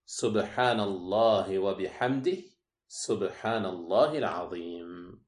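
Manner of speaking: slow praise with lengthened vowels; intoned dhikr. Acted. Teacher reciting the two phrases loved by Allah, slow intoning. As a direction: draw each phrase out slowly, sinking at the close. Quotes the hadith's words of praise.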